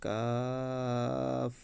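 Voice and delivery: কাফ drawn out very slowly; Arabic letter demo. Shown as a demonstration. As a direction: hold কাফ long and clear. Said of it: "তারপর". (ধীরে টেনে কাফ উচ্চারণ)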